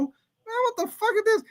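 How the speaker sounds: high, startled mock yelp; squeaky mock fright in a dim hallway; mock spooked. Spooked in dark basement hallway.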